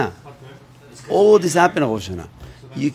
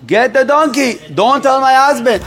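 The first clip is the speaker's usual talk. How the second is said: raised, pressing order to the servant; urgent command; 7 semitones higher, 4 dB louder than usual. Acted. Mother urgently orders the servant, raised voice. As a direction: demand it loudly, no time to lose.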